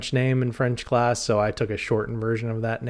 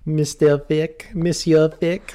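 slow, hammed-up French accent; 4 semitones higher, 3 dB louder, slower than usual. Shown as a demonstration. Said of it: "In the best French accent".

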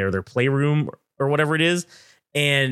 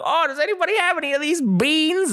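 much higher, sing-song host voice; mock cheery staffer; 13 semitones higher than usual. Staff asking the kids for beans, in a high cheery host voice. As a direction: play a cheerful game host.

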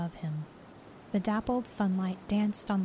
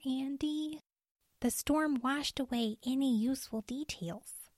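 lightly voiced woman ranger, higher and softer; weary ranger; 5 semitones higher, 3 dB quieter than usual. Cheryl tells Andy the storm washed away clues, soft and weary. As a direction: report the empty search, tired and soft.

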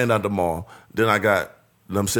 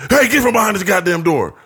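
raised, higher mock yell; loud higher voice, hamming up her anger; retold yell; 7 semitones higher, 5 dB louder than usual. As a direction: bark it like she caught you. Woman yelling at intruder.

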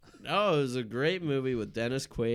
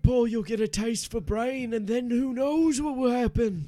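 high, urgent plea; urgent warning; 13 semitones higher, 7 dB louder than usual. Acted. Warning Paul not to sip the brew, high and urgent. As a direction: plead in a raised, high voice.

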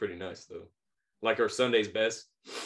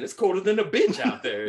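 higher, louder mock of the lady; lady voice again; 9 semitones higher, 6 dB louder than usual. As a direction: quote her barging in, loud and high. Retelling lady busting in again.

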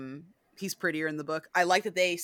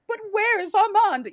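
much higher and louder, a hammed-up pining wail; whiny mock Louis; 8 dB louder than usual. Louis searching for Armand in the book, mocked much higher and louder. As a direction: overplay the lovesick question. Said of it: "you didn't need Louis going".

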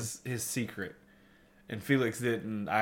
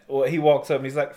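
usual speaking voice

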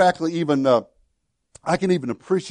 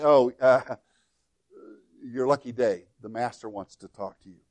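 sheepish backpedal; 5 semitones lower, 4 dB quieter than usual. Retold or imitated. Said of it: "kind of eat a little crow".

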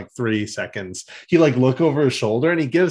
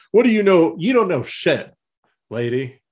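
indignant Silverbolt; 7 semitones higher than usual. Retold or imitated. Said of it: "he's like".

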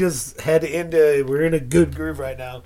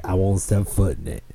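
lower, gruff comic refusal; Joey Diaz impression; 8 semitones lower than usual. Retelling Joey Diaz's pizza bit to his friend, low gruff refusal. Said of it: "he's like".

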